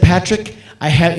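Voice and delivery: ordinary delivery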